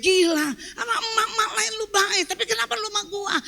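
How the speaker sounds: high-pitched resentful protest; acted indignant complaint. Wife resenting kindness to other women, high and indignant. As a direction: imitate an aggrieved wife protesting.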